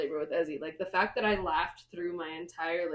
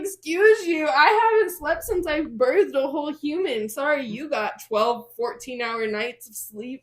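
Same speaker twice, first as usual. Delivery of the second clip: sassy scolding; 5 semitones higher, 6 dB louder, slower than usual. Retold or imitated. Mom scolds husband at home.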